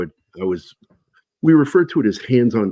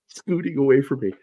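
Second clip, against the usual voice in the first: ordinary talk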